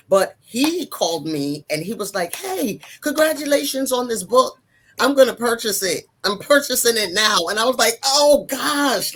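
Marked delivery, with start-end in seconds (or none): 2.4-7.5 imitating an eager caller, raised pitch; enthusiastic congratulations. Ex-husband phoning to congratulate her, retold with high enthusiasm. 8.0-9.2 high, loud comic groan of dismay; mock dismay. Woman learning her ex will read it, retold mock dismay. Retells dread at ex buying book.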